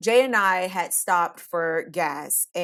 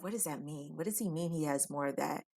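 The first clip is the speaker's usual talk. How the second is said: inner thought; 3 semitones lower, 12 dB quieter, faster than usual. Retold or imitated. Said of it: "I'm thinking to myself".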